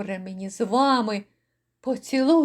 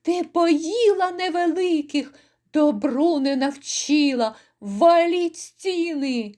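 higher raging shout; 6 semitones higher than usual. A narrator's reading. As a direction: order the walls torn down in fury. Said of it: "лютує".

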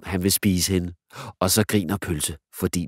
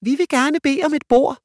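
much higher, louder posh-lady put-on; 7 dB louder than usual. Girl playing a fine lady at the restaurant, high voice. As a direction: play a fine lady ordering. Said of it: "siger Tusse med høj damestemme".

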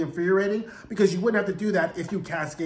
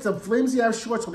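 normal talking voice

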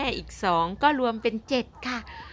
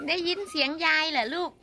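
normal talking voice

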